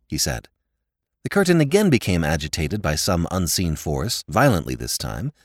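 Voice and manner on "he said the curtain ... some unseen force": usual speaking voice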